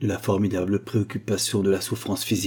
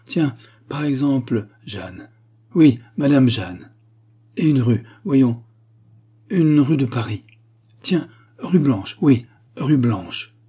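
higher, louder, groping for a made-up answer; forced improvising; 5 semitones higher, 6 dB louder than usual. A narrator's reading. Father invents an address for his daughter, forced improvising. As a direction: invent it on the spot with forced decisiveness.

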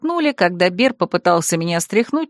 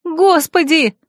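high dramatic wail; crying out to heaven; 9 semitones higher, slower than usual. A narrator's reading. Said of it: "возопила я".

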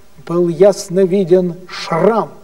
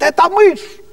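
frightened shriek; 11 semitones higher than usual. Retold or imitated. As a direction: shriek it like a panicked empress. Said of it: "императрица кричит".

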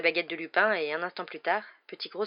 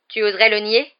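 loud, higher-pitched accusing question; angry challenge; 4 semitones higher, 13 dB louder than usual. Acted. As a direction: dare him to deny it. (défi accusateur)

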